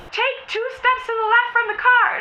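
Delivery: high, put-on officer order; police command voice. Police repeating the order to her, commanding. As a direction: shout it like a cop on a megaphone. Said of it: "they're like".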